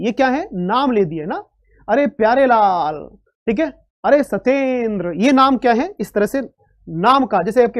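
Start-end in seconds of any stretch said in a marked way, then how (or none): 1.9-3.1 slow call with "अरे" held long; drawn-out calling. Shown as a demonstration. Teacher demonstrates prolonged vowel by calling a name slowly. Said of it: "दीर्घ काल तक यानी कि लंबे समय तक उच्चारित करते हैं". 4.0-5.1 slow call with "अरे" held long; drawn-out calling. Shown as a demonstration. Teacher demonstrates prolonged vowel by calling a name slowly. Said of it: "दीर्घ काल तक यानी कि लंबे समय तक उच्चारित करते हैं".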